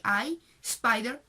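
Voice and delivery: "I, spider" drawn out slowly, the i as "eye"; English i sound. Shown as a demonstration. Teacher explaining the social convention, slow English i sound. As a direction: slowly demonstrate "I" as "eye", then "spy-der". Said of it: "la I en inglés tenía que sonar".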